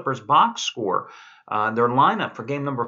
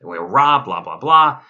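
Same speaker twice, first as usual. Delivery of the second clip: mocking complaint; 4 semitones lower, 9 dB louder, slower than usual. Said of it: "Doc Rivers came out and".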